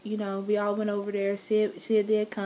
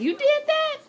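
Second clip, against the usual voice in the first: high, surprised squeal; shocked friends; 4 dB louder than usual. Retold or imitated. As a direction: gasp it out in disbelief.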